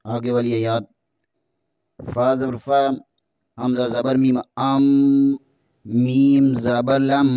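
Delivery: ordinary talk, nothing marked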